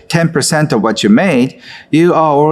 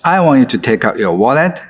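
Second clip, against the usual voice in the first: ordinary delivery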